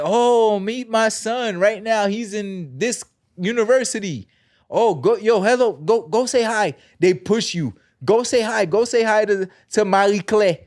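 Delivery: high, loud Haitian mom bragging; proud Haitian parent showing off; Haitian accent. Retold or imitated. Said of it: "I just put the Haitian accent on".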